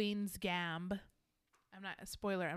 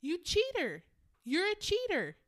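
retold outburst at the TV; 6 semitones higher, 4 dB louder than usual. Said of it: "And I was like".

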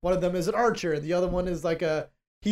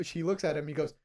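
normal talking voice

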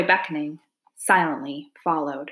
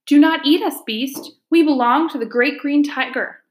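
high, loud frightened cry; cried out; 8 semitones higher, 4 dB louder than usual. A narrator's reading. Girl crying out to the dark beast in fear. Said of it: "I cried out".

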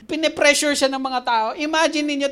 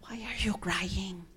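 very soft, slow, whispery question; comforting; 4 semitones lower, 11 dB quieter, slower than usual. Acted. The Lord consoling a crying Moses, soft and comforting. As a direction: ask it gently, almost whispered, to console. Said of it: "comforting word. Nako-comfort siya".

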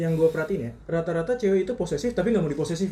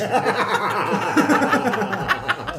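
loud high-pitched laughter; 6 semitones higher, 5 dB louder than usual. Real, not acted. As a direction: crack up laughing. Laughing at cringe remark.

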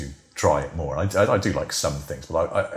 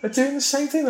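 shrill disbelieving cry, much higher; retold shock at the chip packet; astonished; 3 dB louder than usual. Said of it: "And I was like".

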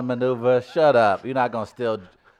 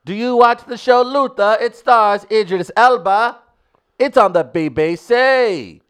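loud, high, hammed-up mock British; British accent; 8 semitones higher, 9 dB louder than usual. Comic showing off his accent to doubting co-hosts, mock British accent. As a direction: go whole hog into a bad British accent. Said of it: "He just went whole hog into that accent".

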